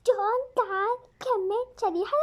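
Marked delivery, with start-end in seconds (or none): none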